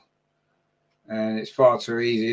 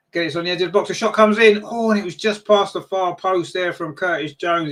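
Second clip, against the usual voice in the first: excited shot call; 6 semitones higher, 3 dB louder than usual. Real, not acted. Shot just past the post.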